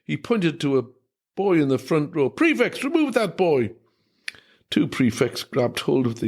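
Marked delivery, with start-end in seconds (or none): 2.3-3.7 angry command. Acted. Headmaster orders boy removed.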